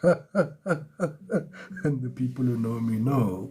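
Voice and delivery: slow, laughing through the words. Real, not acted. Reader joking about her own sign while laughing. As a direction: chuckle it out as an inside joke.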